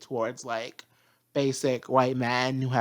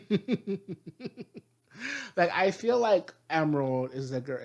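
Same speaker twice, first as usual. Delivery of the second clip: ordinary talk, nothing marked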